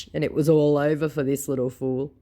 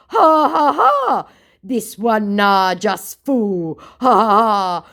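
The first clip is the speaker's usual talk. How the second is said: unhinged laugh; 7 semitones higher, 8 dB louder, slower than usual. Retold or imitated. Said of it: "He was completely unhinged at this point".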